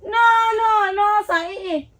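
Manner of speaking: high-pitched, disbelieving no; shocked refusal. Acted. Partner rejecting the confession, in high-pitched disbelief.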